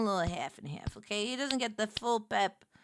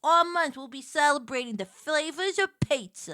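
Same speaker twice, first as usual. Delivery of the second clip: news anchor; 4 semitones higher, 5 dB louder than usual. Pretend. Mock newscast about pizza.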